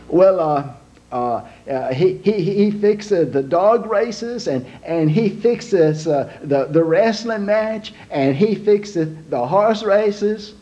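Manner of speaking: put-on slow, proud Italian accent; Italian papa boasting slowly in an Italian accent. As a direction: brag about your son in a broad Italian accent. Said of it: "an Italian man named Big Lorenzo".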